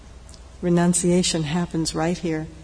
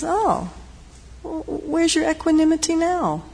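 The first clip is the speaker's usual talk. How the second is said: teasing jab; 9 semitones higher than usual. Acted. Visitor mocks the angry monk.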